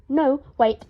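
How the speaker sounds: ordinary delivery, nothing marked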